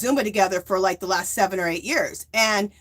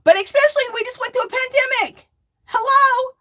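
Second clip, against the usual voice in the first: much higher, louder exasperated rant; worked-up rant; 13 semitones higher, 4 dB louder than usual. Real, not acted. Venting to viewers about strangers, high exasperated rant. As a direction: get riled up about it.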